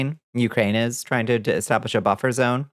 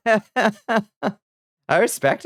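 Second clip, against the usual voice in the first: said through slow laughter; laughing; 8 semitones higher, much slower than usual. Real, not acted. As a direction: laugh it out, drawn way out.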